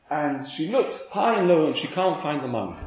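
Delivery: ordinary talk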